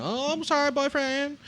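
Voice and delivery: very high falsetto mock apology. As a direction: put on a squeaky-high voice to apologize. Acted mock apology to boyfriend.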